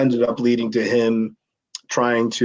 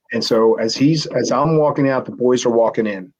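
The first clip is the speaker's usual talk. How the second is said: ordinary talk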